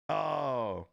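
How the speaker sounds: ordinary talk, nothing marked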